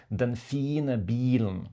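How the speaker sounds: den fine bilen with the i of fine held long. Shown as a demonstration. Teacher showing a learner the long i in fine. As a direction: stretch the i in fine. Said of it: "le i est beaucoup plus long".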